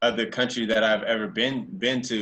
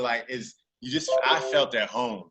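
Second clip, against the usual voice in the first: ordinary delivery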